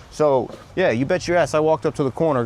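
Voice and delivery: ordinary talk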